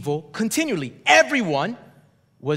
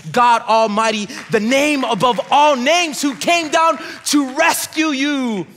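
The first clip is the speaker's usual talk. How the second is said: rising preacher shout; 5 semitones higher, 4 dB louder than usual. Real, not acted. Preacher building to a shout about Jesus to the church. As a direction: build to a loud, high proclamation.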